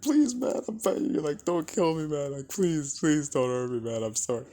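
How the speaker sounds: high, loud frantic pleading for his life; desperate begging. Retold or imitated. Beaten bully begs Michael not to kill him, high and frantic. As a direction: beg like you're about to be killed. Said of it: "he's like".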